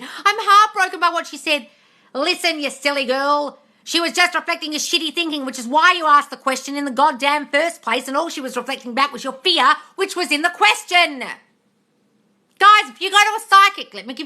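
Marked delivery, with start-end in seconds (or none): 0.0-1.7 mock quote. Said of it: "someone did this morning and said". 2.1-11.2 exasperated scolding. Real, not acted. Scolding a viewer about psychics. 12.6-13.9 high, loud call to the viewers; loud warning. Real, not acted.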